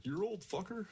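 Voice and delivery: high, mocking stomach voice; talking stomach voice.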